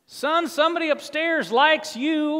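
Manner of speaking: high hearty country voice; tow truck driver Bubba. Retold or imitated. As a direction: put on the big country tow-man.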